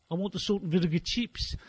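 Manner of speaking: "salt and vinegar chips" said in a put-on Australian accent; the faked Australian teen asks for "chips"; mock Australian accent. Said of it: "pretend you're Australian for the day".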